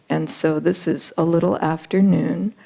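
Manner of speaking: ordinary delivery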